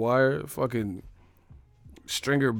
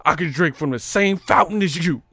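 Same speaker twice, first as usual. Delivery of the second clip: put-on higher, louder earnest school-kid voice; mock proud Bill Clark; 4 semitones higher, 4 dB louder than usual. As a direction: play the proud first integrated student. Acting Bill Clark as proud integrated student.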